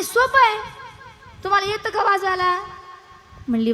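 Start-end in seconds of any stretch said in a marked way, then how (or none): 0.0-2.6 much higher, mock female voice; lightly voiced village woman; woman's voice. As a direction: play a chirpy village woman.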